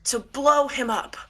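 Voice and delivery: high-pitched, raving villain voice; unhinged Miracle figure, manic. Acted.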